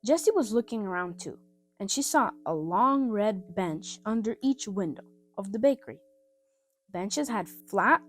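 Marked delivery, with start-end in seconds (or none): none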